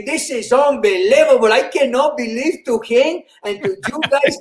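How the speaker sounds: heavy mock Spanish accent; Spanish guy. Comedian replaying his Spanish guy act on stage, mock Spanish accent. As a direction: put on a comic Spanish-accented voice. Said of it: "I was this Spanish guy".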